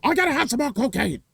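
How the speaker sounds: high, fast, manic voice; hammed-up manic scientist; Doc Brown. Shown as a demonstration. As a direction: blurt it out high and frantic. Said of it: "he would talk like this".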